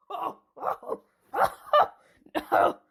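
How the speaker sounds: high panicked cries; rising frightened oh; scared yelps. Acted. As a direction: yelp in fright. Attacked by a lion.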